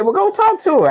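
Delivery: high and loud, a hammed-up tipsy buddy; drunk friend. Drunk friend urging Will toward Heather's table, loud high push. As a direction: play the drunk wingman egging him on. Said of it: "And he said".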